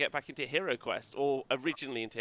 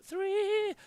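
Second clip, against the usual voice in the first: excited exclamation. Real, not acted. Enthusing about number three.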